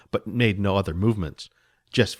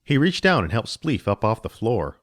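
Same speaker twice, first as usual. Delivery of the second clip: plain reading voice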